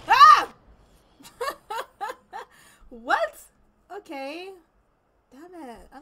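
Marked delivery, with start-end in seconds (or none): 0.1-0.5 short, high, very loud yelp; startled scream. Real, not acted. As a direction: scream at a sudden jump scare. Startled by the game.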